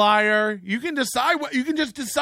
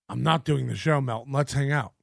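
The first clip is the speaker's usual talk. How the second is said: low mocking voice; 8 semitones lower, 4 dB quieter than usual. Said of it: "imitating the guest's messages".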